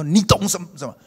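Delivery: man yelling at his small-group leader; angry yell. Retold or imitated. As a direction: shout it back in anger. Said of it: "他就很大声的骂说".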